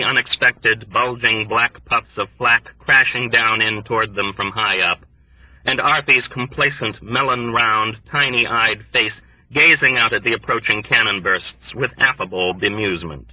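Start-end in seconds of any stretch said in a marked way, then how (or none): none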